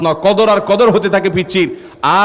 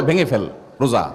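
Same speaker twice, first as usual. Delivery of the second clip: tempting murmur; 6 semitones lower, 5 dB quieter than usual. Acted. Satan tempting the believer.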